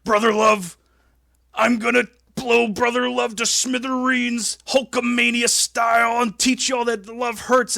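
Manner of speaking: high, loud gruff Hogan promo; Hulk Hogan impression. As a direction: roar it like Hulkamania on a green screen. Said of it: "He's like".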